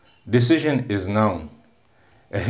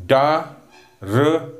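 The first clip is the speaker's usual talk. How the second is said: "Da, r, t" as three separate, higher-pitched beats; split into syllables; 4 semitones higher, slower than usual. Shown as a demonstration. Teacher sounding out the syllables of direct, "Da, r, t" one by one. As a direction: sound out "Da, r, t" with a pause after each. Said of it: "Three syllables".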